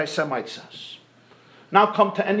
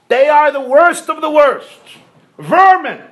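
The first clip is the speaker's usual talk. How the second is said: loud, higher, venomous declaration; hateful rant; 7 semitones higher, 7 dB louder than usual. Acted. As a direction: spit out Vermin in disgust.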